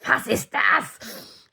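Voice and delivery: high, very slow, mock-German question; the girl voiced in mock German, high and drawn out. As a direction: stretch it out like a comic foreigner. Mock foreign take on girl's reaction.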